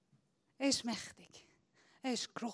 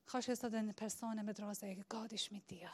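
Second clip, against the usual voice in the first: softer, unvoiced whisper; 4 semitones lower, 14 dB quieter, faster than usual. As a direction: invite them gently in a whisper. Preacher whispers to congregation. (flüsternd)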